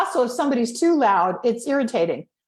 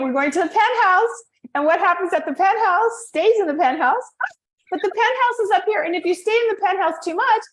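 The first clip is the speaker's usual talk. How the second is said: raised high register; penthouse voice; 7 semitones higher than usual. Shown as a demonstration. Coach demonstrating the top of the voice staircase. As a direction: lift the voice up to the penthouse. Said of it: "penthouse is where your voice can go higher".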